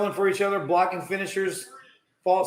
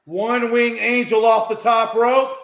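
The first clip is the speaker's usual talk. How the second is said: raised, louder play-by-play; excited call; 4 semitones higher, 5 dB louder than usual. Real, not acted. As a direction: call the big move with energy.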